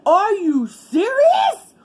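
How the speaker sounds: high, slow, disbelieving cry; incredulous. Retold or imitated. Asking husband in the car, high and disbelieving. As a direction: stretch it out in total disbelief. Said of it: "I said".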